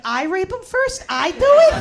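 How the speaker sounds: slow, then a very high loud squeak; confused child. Retold or imitated. Answering her dad, a startled childlike squeak. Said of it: "And I'd be like".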